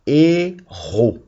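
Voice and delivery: "héros" in two slow, separate syllables; sounding out. Shown as a demonstration. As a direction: dictate "héros" as hé... ros. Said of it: "on entend presque tout".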